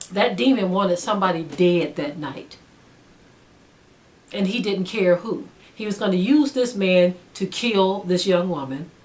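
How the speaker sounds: usual speaking voice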